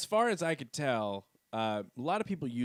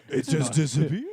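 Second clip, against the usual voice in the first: laughing excuse; 4 semitones higher, 8 dB louder than usual. Pretend. Empire excuses about Alderaan.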